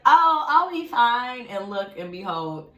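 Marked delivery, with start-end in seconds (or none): 0.1-1.5 high, slow, louder carefree brush-off; sing-song mock confidence; breezy overconfidence. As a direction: toss it off like nothing can go wrong. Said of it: "But I was like".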